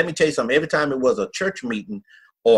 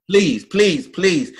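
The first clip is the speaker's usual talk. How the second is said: retold begging; 6 semitones higher, 3 dB louder, much slower than usual. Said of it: "She begging me".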